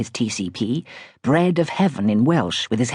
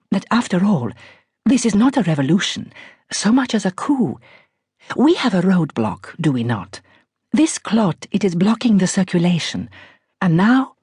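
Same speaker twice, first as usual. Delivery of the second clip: higher, poised posh English with a faint Iranian R; light posh Iranian lilt, calmly dramatic; posh Iranian accent; 6 semitones higher than usual. A narrator's reading. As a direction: spin the metaphor like a grand Persian lady. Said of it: "tremendously posh voice with its just perceptible Iranian R".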